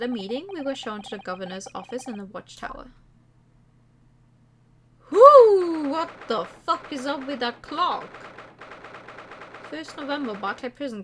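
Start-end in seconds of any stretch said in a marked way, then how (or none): 5.1-5.9 whoop. Real, not acted. Excited shout at odd clock.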